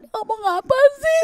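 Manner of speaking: retold flustered thought; nervous confusion. Flustered tourist thinking, high baffled confusion. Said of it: "Agak deg-degan gitu".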